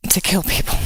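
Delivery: breathy, near-tearful whisper; voice breaking. Real, not acted. Minister near tears over gun violence, voice breaking. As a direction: let the voice give way on the words. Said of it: "I get a little emotional about that".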